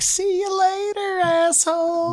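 high, slow sing-song friendliness with a sneer; mock cheery local. To a friend about Knoxville, mock sweet farewell. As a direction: drawl it like fake-sweet goodbye. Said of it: "a different kind of friendly".

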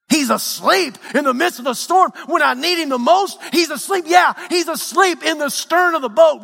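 high-pitched, incredulous sermon cry; urgent pulpit exclamation; impassioned preaching. Acted. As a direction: cry it out as a dismayed disciple, preacher-style. Preaching at full force.

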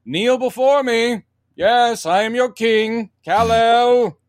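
put-on high, grand British accent. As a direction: lord it over them in British accent. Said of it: "he has a British accent at that point".

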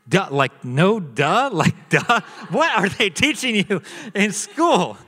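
incredulous dad, amused and emphatic; comically flabbergasted parent; baffled disbelief. Retold or imitated. Dad baffled by son's word.

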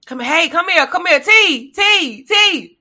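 calling out, building in urgency; grandma calling. Retold or imitated. Grandma calling T from the bedroom, building call.

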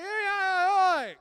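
imitating a two-year-old yelling unclear words; toddler babble. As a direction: shriek baby nonsense like a two-year-old. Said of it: "we don't completely understand her".

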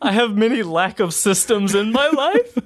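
high, giggling the words out; laughing quote. Real, not acted. Quoting his co-host back to him, laughing hard. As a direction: repeat the line while cracking up. Repeating co-host's funny line.

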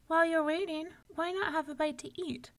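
high, sing-song hostess; sweet wife. A narrator's reading. Una offering the giant bread, sweetly. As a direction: offer food with sugary innocence. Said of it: "said Una with her sweetest smile".

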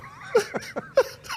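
a burst of airy high laughter; amused giggle; high breathy laugh. Real, not acted. Laughs at own joke.

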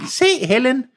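put-on a child showing off her swimming; high young girl voice; child's voice. A narrator's reading. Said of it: "sagde Enola".

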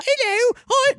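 put-on high falsetto old-lady hello; Mrs Doubtfire voice. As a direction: chirp a high, fussy nanny greeting. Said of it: "That's, that's just Doubtfire".

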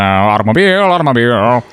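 lightly voiced old-time automobile enthusiast; a hint of twenties slang-slinging character; nineteen-twenties American. Host evoking the nineteen twenties, lightly put-on old-timey voice. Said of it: "like, nineteen twenties and the New York Yankees".

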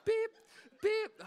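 high, short beeps; imitated scanner beep. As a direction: mimic a self-checkout scanner. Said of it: "I'm going".

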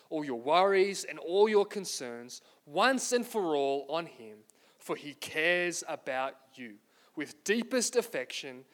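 slow, measured scripture reading. Acted. Pastor reads the verse to the congregation, slowly. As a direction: read each phrase slowly, letting it land.

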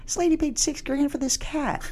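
high, hammed-up disbelief; mock outrage. Joking to a poker player about a cat, high mock disbelief. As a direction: play up the crude punchline. Joking crude line at table.

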